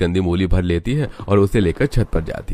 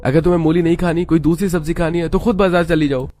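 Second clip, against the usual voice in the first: angry scolding; 9 semitones higher than usual. Acted. Husband angrily scolding his wife for disrespecting his mother. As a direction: tell her off hard for talking back.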